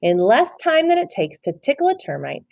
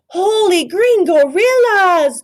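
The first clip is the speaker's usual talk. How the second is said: high, loud, slow astonished cry; gulped in shock; 12 semitones higher, 9 dB louder, slower than usual. A narrator's reading. Scipito spots the creature, astonished. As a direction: gasp it in amazement. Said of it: "gulped Scipito".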